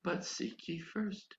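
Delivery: very soft, slow hushed voice; barely above a breath, slow; quiet little voice. Retold or imitated. God's quiet voice repeating to the stubborn preacher, slow and soft. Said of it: "that little quiet voice comes again".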